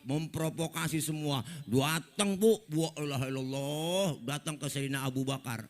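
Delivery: ordinary delivery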